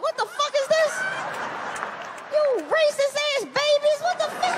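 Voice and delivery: put-on shocked father squealing at his kids; high outraged disbelief. Outraged at kids' nickname.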